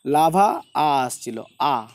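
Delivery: লাভা very slow, the final aa held long; drawn-out aa. Shown as a demonstration. Teacher pointing out the vowel to students, slow লাভা with long aa. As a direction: stretch the আ in লাভা, then sound it alone. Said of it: "দেখো, খেয়াল করো".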